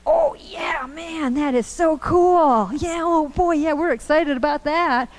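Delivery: high, gushing, overeager mock kids; mock excited kids. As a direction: ham up the kids cheering for free stuff. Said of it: "And they're like".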